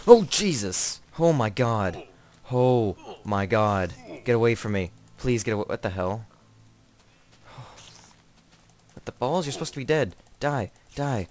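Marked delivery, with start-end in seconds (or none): 0.1-5.6 loud panicked yelps, louder; real fright. Real, not acted. Spider jumps at the player, panicked loud cries. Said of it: "God, that scared me so much". 8.9-11.3 frustrated. Real, not acted. Spider he hit won't die.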